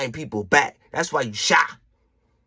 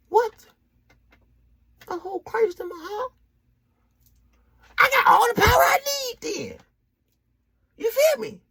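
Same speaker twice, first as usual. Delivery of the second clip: much higher, crying out in rapture; ecstatic cry. Acted. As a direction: shout it like revelation hits. Ecstatic realization of faith.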